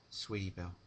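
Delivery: plain reading voice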